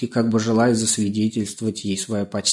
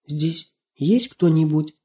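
put-on high, slow girl's call; tentative calling; 7 semitones higher, slower than usual. A narrator's reading. Girl entering the library asks who's there, tentative. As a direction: feel out who is there. Girl calls into the dim room.

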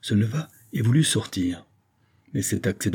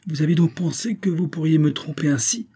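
high-pitched indignant question; exasperated; 7 semitones higher than usual. A narrator's reading. Vicomte accusing Saphir of deceiving him, exasperated. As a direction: demand it, exasperated, voice raised in pitch. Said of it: "poursuivit monsieur de la Morlière exaspéré".

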